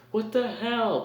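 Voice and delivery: high startled what the hell; shocked outburst. Retold or imitated. Billy reacting in retold scene.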